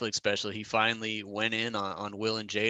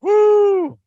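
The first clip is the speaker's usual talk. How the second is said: loud, very high whoop; loud high celebratory woo; cheering; 15 dB louder than usual. Real, not acted. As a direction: whoop like a fan at a show. Co-host cheers after the bit.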